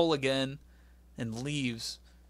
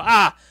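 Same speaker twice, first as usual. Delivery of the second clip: short loud jump-scare yell; mock startled scream; 5 semitones higher, 11 dB louder than usual. Host acting out a jump scare with a yell. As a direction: yelp like something jumped out. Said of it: "You go".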